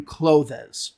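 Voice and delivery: clothes as "KLOH-thez"; two-syllable clothes with an added EZ; mispronounced clothes. Shown as a demonstration. Teacher giving a wrong example sentence with clothes as "kloh-thez". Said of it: "This is wrong. It's not two syllables".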